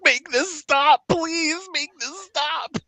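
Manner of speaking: high-pitched desperate plea; strained, high begging; pleading dwarf. Acted. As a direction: beg for it to end in a high voice. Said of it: "the dwarf's like".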